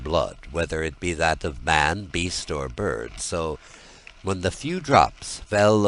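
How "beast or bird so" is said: plain reading voice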